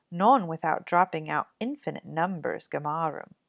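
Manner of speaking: ordinary delivery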